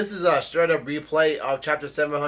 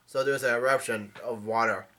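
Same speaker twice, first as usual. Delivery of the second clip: normal talking voice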